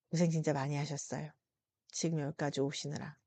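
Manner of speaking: soft sincere comfort. Real, not acted. Comforting tired listeners.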